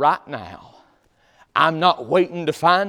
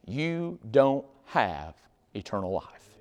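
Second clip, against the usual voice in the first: solemn quiet; 5 semitones lower, 8 dB quieter, slower than usual. Real, not acted. Solemn slow warning.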